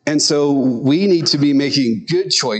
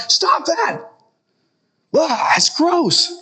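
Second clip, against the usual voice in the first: mock yell at dog; slower than usual. Yelling at his dog to stop, high disgusted mock yell. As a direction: squeal it at the dog, slow and grossed out. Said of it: "Yell at your dog".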